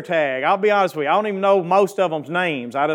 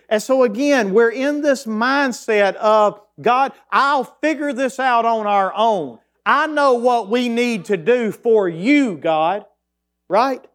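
rising, higher preacher's voice, drawn out; fired-up preaching; 6 semitones higher, slower than usual. Acted. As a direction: preach it to the congregation with growing fervour. Preaching build.

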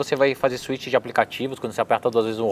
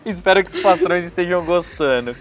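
laughing; 6 semitones higher than usual. Real, not acted. Still laughing after fit.

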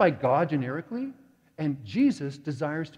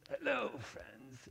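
mock Charlie; 6 semitones higher, 11 dB quieter, much slower than usual. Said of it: "and he says".

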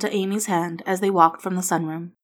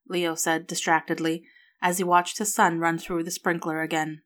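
plain reading voice

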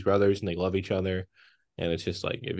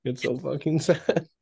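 a high, slow, teary imitation of a crying friend; mock sobbing friend; 5 semitones higher, 4 dB quieter, slower than usual. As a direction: lightly imitate a sobbing buddy, drawn out. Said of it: "And he's like".

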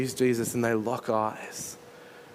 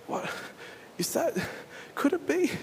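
high, breathless disbelief; astonished wonder; 13 semitones higher, 4 dB quieter than usual. Acted. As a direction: let the pitch climb in hushed amazement. Peter wonders at the figure.

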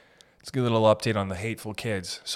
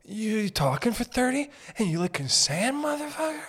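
mock incredulous friend; 9 semitones higher than usual. Acts out incredulous friend reply.

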